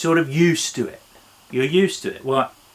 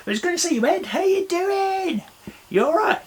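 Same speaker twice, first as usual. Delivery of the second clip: gushing greeting. Acted. Greets the puppet Eddie.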